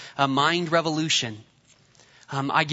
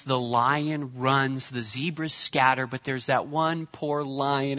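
usual speaking voice

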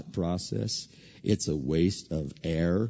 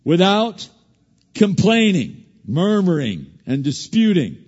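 preaching; 10 semitones higher, 11 dB louder than usual. Acted. Preacher crying out scripture to the congregation, loud. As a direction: drive the scripture home.

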